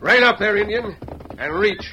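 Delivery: loud gunpoint order; commanding shout. Acted. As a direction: bark the order at him. Sheriff halts rider at gunpoint.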